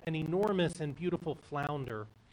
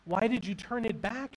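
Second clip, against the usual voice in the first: demanding; 6 semitones higher, 3 dB louder than usual. A narrator's reading. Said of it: "she demands".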